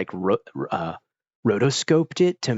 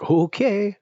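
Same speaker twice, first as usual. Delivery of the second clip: baffled okay; 11 semitones higher, 4 dB louder than usual. Retold or imitated. Reacting to goofy game moments.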